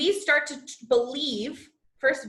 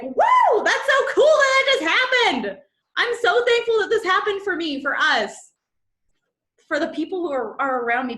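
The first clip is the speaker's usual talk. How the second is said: laughing, much higher, loud whoop of joy; celebrating; 8 semitones higher, 7 dB louder than usual. Shown as a demonstration. Coach shows celebratory thanks to her audience, whooping. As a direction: cheer like you just won. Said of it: "but, like, a celebration".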